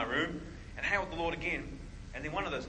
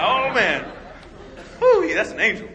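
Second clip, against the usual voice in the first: high, loud burst of awe; awed exclaim; 10 semitones higher, 12 dB louder than usual. Retold or imitated. Realizing the light is an angel, a loud awed outburst. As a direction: cry out in startled wonder. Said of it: "So I was like".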